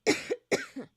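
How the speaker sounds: sharp chesty cough. Real, not acted. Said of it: "I'm coughing in the phone".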